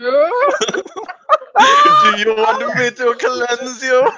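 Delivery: Vincent Price impression. Player mocks the cleanse line as Vincent Price, hammy. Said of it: "Damn it, Vincent Price".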